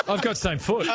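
normal talking voice

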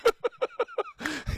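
high burst of delighted laughter; laughing. Real, not acted. Host cracking up at the caller's singing, high laugh. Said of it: "Give the man a round of applause".

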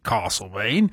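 "Castlemaine" said as Kahssel-main; wrong broad Castlemaine. Shown as a demonstration. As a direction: give "Castlemaine" a long ah. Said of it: "You can't call it".

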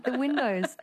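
high-pitched, laughing through the words; amused disbelief. Real, not acted. Singer recalling her big-head costume job, high and laughing. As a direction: let giggles break into the line.

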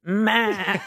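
laughing goat "Ma"; goat bleat. Pretend. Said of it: "Very good goat".

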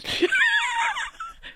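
squealing laugh way up high; helpless high laughter; high shrieking laugh. Real, not acted. Friends laughing hard.

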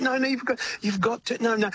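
high, insistent retelling of no no mate; mock-stern friend pushing back hard; severe. Producer friend telling singer not to sound Australian, stern and high. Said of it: "he was really severe on it".

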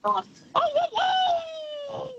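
high whooping shout of a tiger; tiger impression. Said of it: "You wanna hear my tiger impression?".

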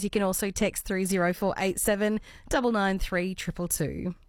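ordinary talk, nothing marked

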